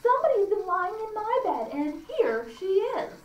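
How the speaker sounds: small bear's high, indignant outcry; little bear's cry. Acted. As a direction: cry out as the little bear discovering an intruder. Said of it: "But then he cried".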